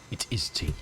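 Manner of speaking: breathy stage whisper. Shown as a demonstration. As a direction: whisper it like a secret. Repeating the tea answer, hushed.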